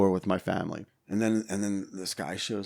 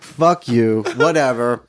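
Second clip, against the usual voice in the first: defiant retort; 2 semitones higher, 11 dB louder, slower than usual. Retold or imitated. Snapping back at his father.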